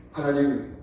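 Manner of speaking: ordinary talk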